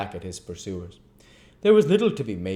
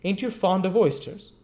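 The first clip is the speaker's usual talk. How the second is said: higher, lazy tipsy youth; higher drunken apprentice; Noah's voice; 11 semitones higher, 5 dB louder than usual. Acted. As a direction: loll and ask idly. Noah asking Charlotte.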